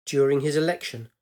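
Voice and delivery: plain reading voice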